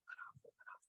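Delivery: barely audible unvoiced whisper. Dad whispering to son to ease his drawn bow. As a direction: hiss it with no voice at all. Coaching son silently in stand.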